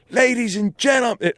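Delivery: put-on high Irish-accented stage announcement; mock Irish rock-star voice. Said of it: "this is my Irish accent".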